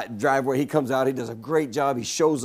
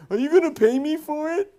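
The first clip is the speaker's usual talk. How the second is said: put-on high, whining grown man like a toddler; mocking a lazy worker as a whiny baby; 13 semitones higher than usual. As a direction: whine it like a big baby. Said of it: "Forty-year-old babies".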